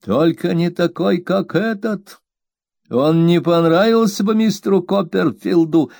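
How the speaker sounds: stubborn servant Peggotty. A narrator's reading. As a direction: dig in your heels as the blunt maid. Peggotty voiced in story.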